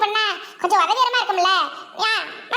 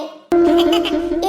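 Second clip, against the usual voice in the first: short amused chuckle; amused laugh; 7 semitones lower, 3 dB louder than usual. Acted. Onlooker caught watching the couple, amused chuckle.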